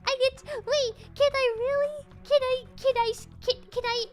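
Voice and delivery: put-on high, eager little kid asking; excited kid voice. Streamer plays a thrilled little kid over sticker money. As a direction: play a child begging for stickers.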